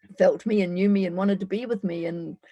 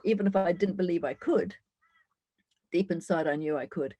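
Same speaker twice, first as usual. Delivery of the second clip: ordinary talk, nothing marked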